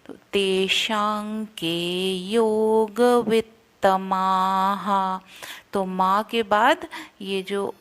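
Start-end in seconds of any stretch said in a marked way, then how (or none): 0.1-5.2 very slow तेषां के योगवित्तमाः, ng in तेषां; ng sound. Shown as a demonstration. Teacher reciting slowly with the ng sound. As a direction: draw out तेषां with an ng nasal. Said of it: "म का उच्चारण नहीं करेंगे, अंग".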